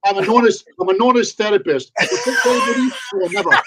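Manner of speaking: loud, high, hammed-up therapist voice; mock honest therapist. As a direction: play a pushy therapist for laughs. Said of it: "special type of therapy".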